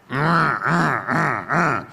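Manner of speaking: put-on high, put-upon teenage humming; sulky grumble. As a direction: grumble your reluctance without words. Son grumbling at father.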